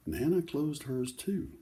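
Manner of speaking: lively picture-book read-aloud; reading to children. Acted. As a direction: tell the story to a circle of kids.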